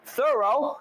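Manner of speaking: THOROUGH high and soft, two clear syllables rising; pronunciation model. Shown as a demonstration. Co-host prompting his partner to copy, high careful THOROUGH. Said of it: "Say it".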